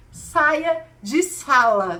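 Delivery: very loud, slow, spaced-out order; slow, loud teacher's ultimatum; stern command. Retold or imitated. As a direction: lay down the law word by word.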